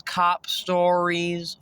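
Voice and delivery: cop stories dragged out slowly. Shown as a demonstration. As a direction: stretch each word of cop stories. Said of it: "repeats slowing down".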